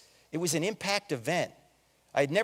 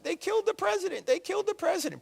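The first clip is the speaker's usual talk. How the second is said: weeping mother; 11 semitones higher, 4 dB quieter than usual. Retold or imitated. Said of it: "weeping uncontrollably".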